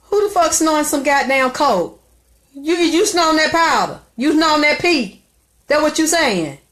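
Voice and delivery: loud, high mocking accusation; mocking mother. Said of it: "She sat in my face and said".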